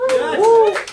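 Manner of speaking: very high, loud celebratory shout; exuberant cheer. Real, not acted. Coach cheers a guest arriving, exuberant shout. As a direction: whoop it out with the crowd.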